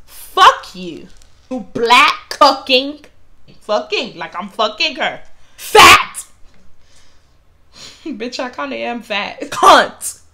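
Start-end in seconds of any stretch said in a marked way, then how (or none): 0.0-6.2 retold scream. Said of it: "She screamed". 9.4-10.2 retold scream. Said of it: "she screams it really loud".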